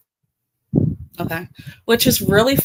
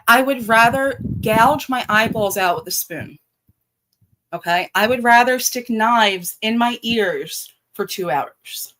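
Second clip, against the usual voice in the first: normal talking voice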